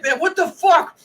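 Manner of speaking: high-pitched imitated angry rant; mock outburst. Teasing co-host imitating friend's blow-up, mock outburst. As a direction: act out him losing his temper. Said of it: "So you would start raising".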